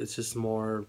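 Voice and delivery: usual speaking voice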